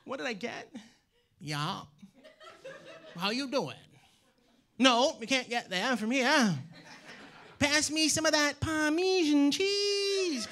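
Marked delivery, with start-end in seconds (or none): 1.4-3.8 mock Maine drawl, understated; Maine accent. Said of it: "But I grew up in Maine". 4.8-10.5 slow, exaggerated Maine drawl; hammed-up rural Maine accent. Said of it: "But I grew up in Maine".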